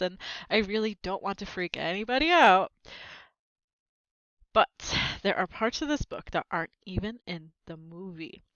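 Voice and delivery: ordinary delivery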